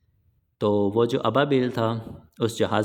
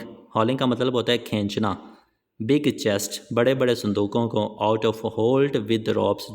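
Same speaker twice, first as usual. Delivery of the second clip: ordinary talk, nothing marked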